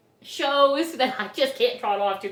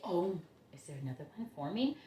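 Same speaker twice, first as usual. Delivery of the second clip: ordinary talk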